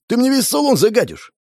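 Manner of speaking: high-pitched flustered protest; flustered alarm. A narrator's reading. Driver worried about his car interior, flustered. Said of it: "всполошился водитель".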